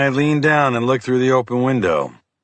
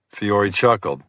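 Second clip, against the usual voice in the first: ordinary delivery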